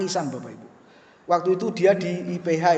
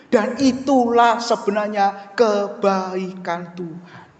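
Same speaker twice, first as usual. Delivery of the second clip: ordinary talk, nothing marked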